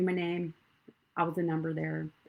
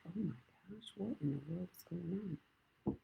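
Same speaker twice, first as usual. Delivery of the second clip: breathless whispered alarm, much softer; shocked whisper; 12 dB quieter than usual. Retold or imitated. Thinking to herself as the coach breaks down, whispered. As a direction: hush it out in disbelief. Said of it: "And I'm like".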